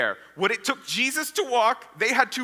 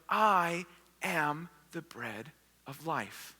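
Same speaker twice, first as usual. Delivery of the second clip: slow, softer, spaced-out pronouncement; solemn declaration; 3 semitones lower, 6 dB quieter, much slower than usual. Acted. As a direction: let each word settle, quietly and slowly. Jesus's solemn declaration, repeated.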